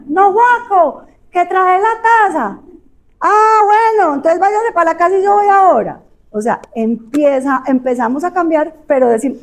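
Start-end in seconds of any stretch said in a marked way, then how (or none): 0.1-6.0 calling husband. Acted. Acted couple dialogue continues.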